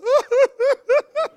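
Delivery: high, loud, pulsing boo-hoo; mock wailing sobs. Husband imitating his wife beside him, wailing sobs. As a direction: imitate loud hysterical sobbing.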